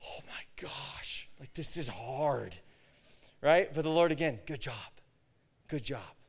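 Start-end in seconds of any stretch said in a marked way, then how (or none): 0.0-2.6 slow, drained whisper, acted; exhausted whisper. Preacher to himself in a crazy, weird service, exhausted whisper. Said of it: "I was so exhausted and drained". 4.4-6.2 soft, low acted praise; quiet reassurance. The Lord to the drained preacher, quiet reassurance.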